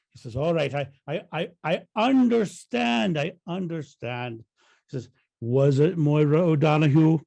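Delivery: lightly put-on Irish priest's voice; mild Irish lilt, the priest pressing; Irish accent. Priest questioning Sean in the joke, light Irish accent. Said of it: "play with my Irish accent".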